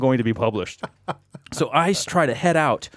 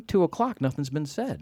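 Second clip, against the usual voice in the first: ordinary delivery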